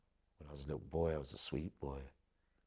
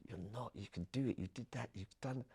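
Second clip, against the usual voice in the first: imitated encouragement; 7 semitones higher than usual. Retells people urging him on.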